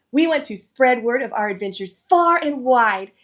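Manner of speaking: plain reading voice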